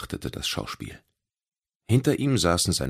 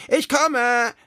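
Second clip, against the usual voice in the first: very high, louder call; 6 dB louder than usual. Acted. Little creature calling to hidden friends, loud and high. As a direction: shout it across the beach.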